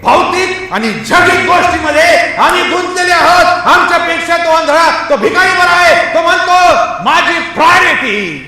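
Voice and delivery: high, loud shouted sermon; full-voiced exhortation; forceful preaching. Real, not acted. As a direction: cry it out to the congregation.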